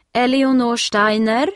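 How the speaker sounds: a lady with a list calling a name, loud and insistent. A narrator's reading. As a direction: call it again so the child will answer. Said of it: "ropar damen med listan".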